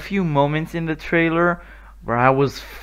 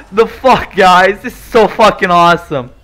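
louder, fast excited praise; gushing to viewers; thrilled; 3 semitones higher, 7 dB louder than usual. Real, not acted. Gamer gushing to his viewers, loud and fast. Trailer reaction.

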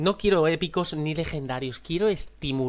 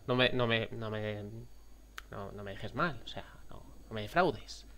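low, soft begging; quiet begging under the breath; hushed pleading; 6 semitones lower, 11 dB quieter than usual. Real, not acted. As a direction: murmur it as a plea.

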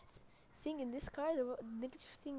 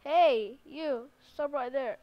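loud command to halt a driver; police officer pulling a car over, loud; cop shouting stop; 11 dB louder, slower than usual. Pretend. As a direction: play the cop yelling at a speeder. Playing cop stopping driver.

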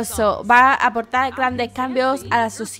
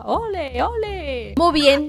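cheering; 4 semitones higher, 4 dB louder, much slower than usual. Real, not acted. Cheering her Sim's cooking.

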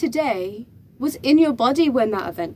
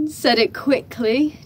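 normal talking voice

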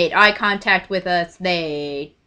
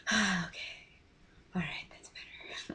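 soft relieved whisper, barely voiced; 17 dB quieter than usual. Sister calming down at intermission, whispered relief. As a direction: breathe it out like a calming sigh. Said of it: "She was like".

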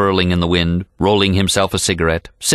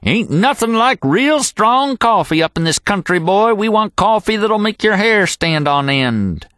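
higher, hearty packer's voice; old cowboy holler; 13 semitones higher than usual. A narrator's reading. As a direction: boast about strong coffee. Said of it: "and yelled".

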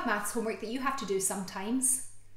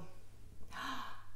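soft delighted gasp; 4 semitones higher, 10 dB quieter than usual. Acted. Excited at the next page.

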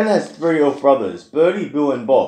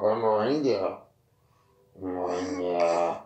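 lower, softer reindeer voice; 8 semitones lower, 7 dB quieter than usual. Acted. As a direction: rumble softly like a reindeer.

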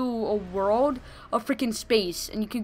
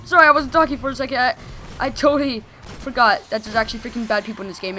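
normal talking voice

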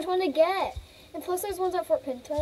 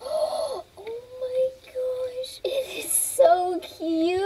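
high, drawn-out squeal after a gasp; gasp, then coo it high and slow; excited gushing; 10 semitones higher, much slower than usual. Real, not acted. Player swooning over a horse for sale, high excited gush.

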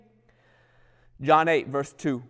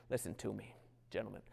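low aside; 6 semitones lower, 17 dB quieter, faster than usual. Acted. Sermon aside.

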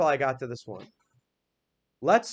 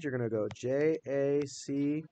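ordinary delivery

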